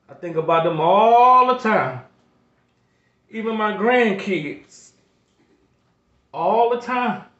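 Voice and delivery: pleading, close to tears, pushing through; emotional. Real, not acted. Grandfather on his channel, overwhelmed thinking of his kids, emotional. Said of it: "I get a little emotional".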